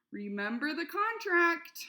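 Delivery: slow, loud and high warning; shouted reminder. A narrator's reading. Mother warning Flora from below, slow loud shout. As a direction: call out a stern reminder. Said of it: "her mother shouted".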